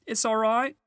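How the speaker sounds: high, loud joyful calling; a beaver shouting the good news; excited shout. A narrator's reading. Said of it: "he was shouting".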